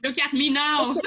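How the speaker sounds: loud, laughing brag; proud laughing boast. Real, not acted. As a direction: show off with a laugh. Proud joke about recent knitting.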